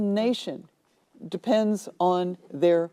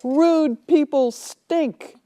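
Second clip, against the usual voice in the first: higher, louder, very slow indignant jab; indignant woman; 8 semitones higher, 5 dB louder, much slower than usual. Retold or imitated. Woman in line scolding the rude customer, indignant. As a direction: drag it out to shame him.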